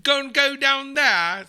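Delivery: loud, high, drawn-out Cockney. Shown as a demonstration. Guest checking what Cockney sounds like, exaggerated Cockney accent. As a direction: belt out a slow mock Cockney example. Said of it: "that's Cockney, right? Like, when it's like".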